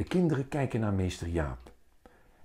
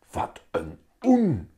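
hissed insult; 6 semitones higher, 4 dB louder, slower than usual. A narrator's reading. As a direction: sneer it slowly, meant to be heard. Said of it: "sist Vera net iets te hard". (langzaam sissend, net te hard)

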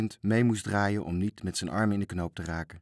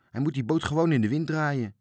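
exasperated; 6 semitones higher than usual. A narrator's reading. Grumbling at Toontje's clumsy sailing.

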